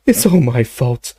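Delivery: high, broken sobbing guilt. A narrator's reading. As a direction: sob out the words. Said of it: "he sobbed".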